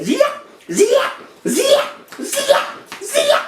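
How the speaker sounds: very high, quick zipping sounds; zip-tie noise. Retold or imitated. Storyteller mimics zip ties on his stomach, zipping noise. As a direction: imitate ties ratcheting tight, high-pitched.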